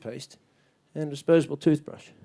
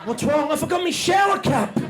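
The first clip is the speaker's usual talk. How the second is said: mock panic in a much higher voice; panicked holidaymaker; 11 semitones higher than usual. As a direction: shriek like you forgot something vital.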